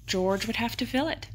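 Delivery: plain reading voice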